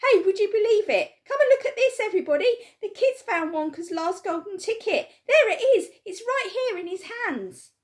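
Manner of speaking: high, loud frantic yelling; excited shouting. A narrator's reading. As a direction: call the whole shop over.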